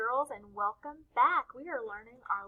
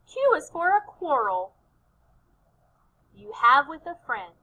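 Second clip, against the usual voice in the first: call-and-response chant with pauses; lilting chanted lines, left open for echoing; cheer; 3 semitones higher, 7 dB louder than usual. Shown as a demonstration. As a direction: lead children in a rhythmic cheer. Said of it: "we're gonna do our Q cheer".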